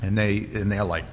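usual speaking voice

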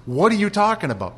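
loud, high and fast in disbelief; husband to wife over the roaring fight, loud disbelief; exasperated outburst. Retold or imitated. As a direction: throw up your hands, everyone is loud. Incredulous husband amid loud fight.

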